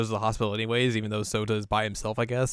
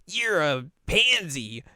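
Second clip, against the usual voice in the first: high, drawn-out sibling taunt; hammed-up exasperated jeer; mocking big sister; 7 semitones higher, slower than usual. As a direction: scoff at a baby brother. Big sister scoffs at scared brother.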